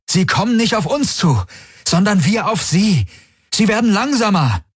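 high-pitched, excited calling. A narrator's reading. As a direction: call the news out urgently, voice pitched high. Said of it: "rief Galen".

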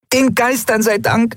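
leicht hohe Frauenstimme, erleichtert; Nelen, erleichtert. A narrator's reading. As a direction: deutlich höher als die Erzählstimme, voller Erleichterung. Nelen voiced, relieved greeting. (Nelen ruft erleichtert, hohe Stimme)

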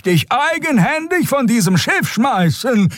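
very high, slow, drawn-out threat; threatening old woman. A narrator's reading. Old Xea threatening to throw Keru off ship, threatening. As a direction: threaten him in a high-pitched character voice. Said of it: "sie könne ihre Drohung wahrmachen".

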